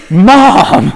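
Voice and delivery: loud, high call through laughter. Retold or imitated. Daughter calling her mom across the booth, loudly through laughter. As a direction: shout for mom while laughing.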